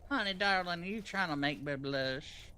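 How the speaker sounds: sweet-talking Southern country wizard; Southern country accent. Pretend. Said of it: "I'm, I'm a country wizard".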